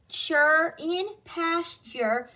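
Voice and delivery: "chure" stretched as CHER, as in pasture; pronunciation demo. Shown as a demonstration. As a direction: slowly model CHURE with a ch and er. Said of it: "So we will pronounce it".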